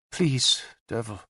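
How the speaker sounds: a soft, pleading murmur; barely breathing the plea. A narrator's reading. Queen pleads quietly with her warrior, softly. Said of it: "she said softly".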